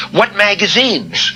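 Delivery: high, loud, drawn-out question; insistent curiosity. Retold or imitated. Guest presses the writer walking away, insistently curious. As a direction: call after him as a nosy guest, slowly.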